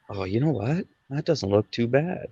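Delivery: quietly recalled thought to oneself; quiet musing. Retold or imitated. Musing to himself over drawing.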